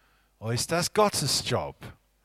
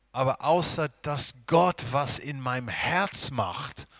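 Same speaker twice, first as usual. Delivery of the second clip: ordinary talk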